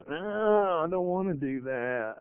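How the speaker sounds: soft, reluctant mutter; grudging self. Retold or imitated. The selfish inner voice refusing God, soft reluctant mutter. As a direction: grumble it under your breath like a sulking inner voice. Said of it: "little thing in us called self that goes".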